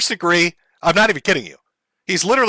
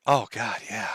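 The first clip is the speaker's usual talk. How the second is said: ordinary delivery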